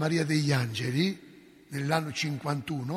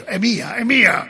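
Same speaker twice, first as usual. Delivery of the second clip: demon's cry; 5 semitones higher, 11 dB louder, slower than usual. Retold or imitated.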